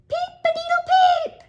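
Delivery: very high, loud squeaky crow; a tiny chick trying to crow like a rooster; chick's crow. Acted. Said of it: "threw back his head and crowed".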